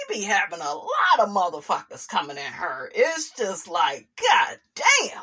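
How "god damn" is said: high exasperated "God damn" rising. Real, not acted. Fed-up host defending Melody, a high exasperated cry. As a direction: throw up your hands at it. Exasperated outburst over harassment.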